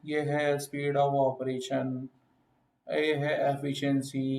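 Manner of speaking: drawn-out, very slow delivery; very slow demo. Shown as a demonstration. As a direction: stretch each word out to show speaking too slowly. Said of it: "मैं बहुत धीरे-धीरे आराम से चलूँगा". (बहुत धीरे-धीरे, खींचकर बोलना)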